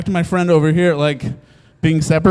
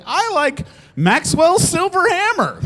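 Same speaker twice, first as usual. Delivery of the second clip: awkward blurt; 12 semitones higher than usual. Retold or imitated. His own awkward answer quoted.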